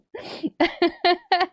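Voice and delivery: high run of giggles; amused laughter. Real, not acted. Streamer teased by a chatter, giggling. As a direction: giggle after a playful telling-off. Laughs after telling Jargon to shut up.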